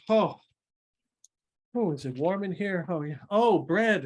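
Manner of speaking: high, exclaiming newcomer; delighted exclamation. Acted. Woman arrives in warm room and spots bread, exclaiming. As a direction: exclaim at each new discovery.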